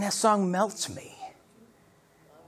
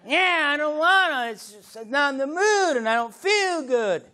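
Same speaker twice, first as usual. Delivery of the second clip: reluctant whining; 6 semitones higher than usual. Acted. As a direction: play a worshipper dragging their feet. Reluctant worshipper answering the call.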